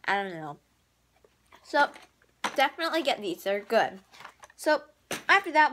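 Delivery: sucking on small candy; tasting while speaking; talking with candy in mouth. Real, not acted. Seen 0: Puts small candy piece in mouth at -1, talks while tasting it.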